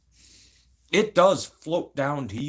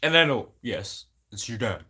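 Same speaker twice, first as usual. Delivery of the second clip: normal talking voice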